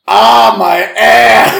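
loud, slow, drawn-out cry of pain; imitating a yell. Retelling a dog's line, a loud slow pained yell. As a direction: belt it out like a man whose backside hurts. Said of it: "he goes".

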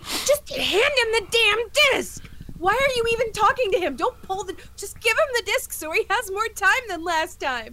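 high-pitched frantic pleading; acted-out exasperated viewer; exasperated urging. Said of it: "then I keep thinking".

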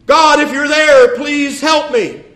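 high, loud, slow cry for help; desperate scream. Acted. Man dangling from a cliff begs God, loud slow scream. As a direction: wail up to heaven, drawn out. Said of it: "He's screaming to God".

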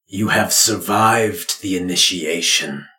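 grave ritual pronouncement; solemn father. Acted. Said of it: "your father speaks".

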